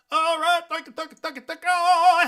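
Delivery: high loud thank yous; high joyful thank yous; excited thanks. Real, not acted. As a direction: throw out thanks with joy.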